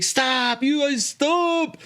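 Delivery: high drawn-out cry to stop; all-out yell. Retold or imitated. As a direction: yell it high, stretching each word. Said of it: "with all my might".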